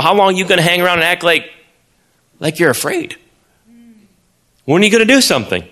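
high, fast, pushing rebuke; impatient young shepherd demanding action; fiery challenge. Acted. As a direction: shame them into acting. David rebuking the soldiers.

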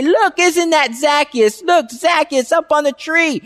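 imitating the crowd making fun of him; mocking crowd. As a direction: point and poke fun at the little man. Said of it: "everyone was making fun of Zacchaeus and say".

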